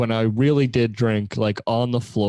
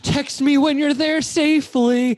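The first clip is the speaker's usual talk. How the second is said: much higher, mock-fussy mother; worried mom voice; 13 semitones higher than usual. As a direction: imitate an anxious mom checking in. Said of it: "but then she'd be like".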